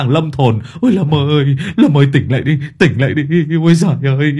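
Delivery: high, chanting funeral wail; howling lament. A narrator's reading. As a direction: howl out a rambling, hammed-up dirge for your friend. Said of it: "miệng thì gào lên".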